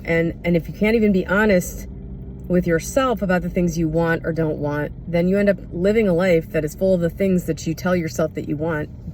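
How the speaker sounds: ordinary talk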